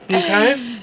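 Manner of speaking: ordinary talk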